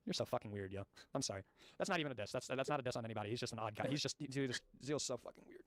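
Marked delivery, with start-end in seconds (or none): none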